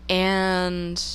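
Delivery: ordinary delivery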